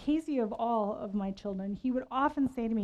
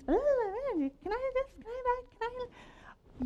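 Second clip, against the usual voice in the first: put-on whiny child. Mother imitating her son begging in the van, high whiny. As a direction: pester like a small boy in a hot van. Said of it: "and he's".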